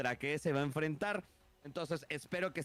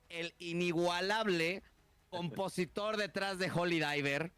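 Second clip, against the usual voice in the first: lucha libre announcer; 3 semitones higher, slower than usual. Acted. Drawn-out ring introduction of partner.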